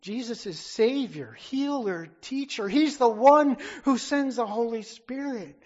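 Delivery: fervent preaching crescendo; rising preached list. Real, not acted. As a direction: build the list to a shout. Listing titles of Jesus.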